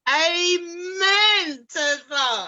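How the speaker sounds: loud, high, crying-out amen; overjoyed shout of amen; fervent amen. Real, not acted. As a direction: call it out like church. Panel host's fervent agreement.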